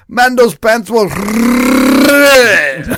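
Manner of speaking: very loud, high French impression with endless R in "red"; exaggerated French accent. Imitating the coworker describing red pants, over-the-top French accent. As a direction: blast out a long French-rolled "red". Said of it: "in his French accent".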